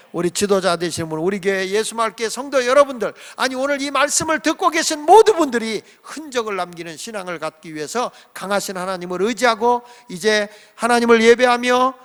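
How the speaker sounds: ordinary delivery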